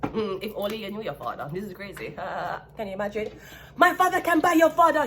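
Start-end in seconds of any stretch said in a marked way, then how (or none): 3.8-5.0 put-on high, loud bratty taunt from a spoiled rich boy; shrill-pitched mock of a rich boy showing off; spoiled rich kid. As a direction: sneer it like a rich brat bragging about daddy.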